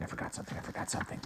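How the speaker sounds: hushed, repeated muttering to oneself; anxious whisper. Acted. Character realising they forgot something, anxious whisper. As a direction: whisper it under your breath.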